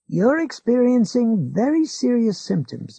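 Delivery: a hint of a smug old man lecturing; McGovern's voice. A narrator's reading. Old neighbour lectures Ralph, a touch smug.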